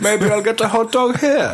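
high, put-on villain voice, amused; mock film villain. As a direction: muse grandly in a silly villain voice. Playing the villain at the football game.

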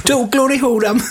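very high comic cry; silly character voice. Pretend. Host adding joke film spoilers, high silly voice.

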